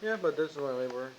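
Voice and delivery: ordinary talk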